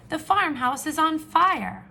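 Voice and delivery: high, loud panicked call; alarmed shout. Acted. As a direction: warn everyone, loud and urgent. Farmer raises the alarm.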